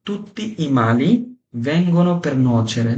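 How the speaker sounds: Non tutti i mali vengono per nuocere drawn out very slowly; slow proverb demo. Shown as a demonstration. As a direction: recite the proverb slowly for learners to repeat. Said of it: "যে বলে". (ধীরে ধীরে প্রবাদটি উচ্চারণ)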